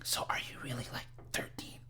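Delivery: barely audible, breath-only imitation; creepy whisper. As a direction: whisper the question furtively. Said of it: "like, whispering".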